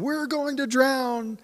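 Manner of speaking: high, slow, drawn-out mock whine; hammed-up panicky cry of drowning; whiny panicked wail. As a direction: wail it like a frightened child. Mocking the fearful disciples' cry.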